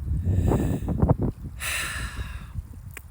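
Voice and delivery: long deep release exhale. Real, not acted. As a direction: let out a long slow breath. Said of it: "just fucking exhale".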